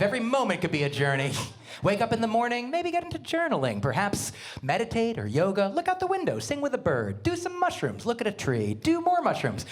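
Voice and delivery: swooping high, then dropping, hammed-up life-coach exhortation; mock spiritual guru. Girlfriend advising a lost customer on beauty, hammed-up spiritual exhortation. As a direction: urge them on like a blissed-out guru, pitch leaping up.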